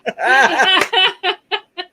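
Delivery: a high, cackling burst of laughter. Real, not acted. Friends teasing Papa Rock, laughing high. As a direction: crack up at the joke, laughing high.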